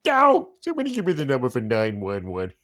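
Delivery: high yelping cartoon dad voice; Homer Simpson. Voice actor showing off his cartoon dad voice. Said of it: "so I started to talk like Homer".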